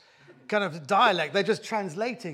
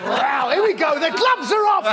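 loud, much higher whoop; excited shout; 10 semitones higher, 9 dB louder than usual. Real, not acted. Panellist reacts to a savage insult, loud high shout. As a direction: cheer as the fight starts.

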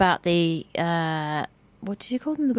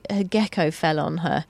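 ordinary talk, nothing marked

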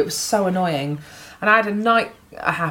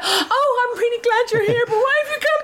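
very high, hammed-up tearful wail; mock crying. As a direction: wail politely through pretend sobs. Acting herself crying at Dawn French.

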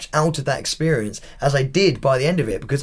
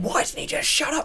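high, softer imitation of a viewer; mock a complaining onlooker in a raised pitch; exasperated viewer; 11 semitones higher, 5 dB quieter than usual. People watching at home complain about him, exasperated.

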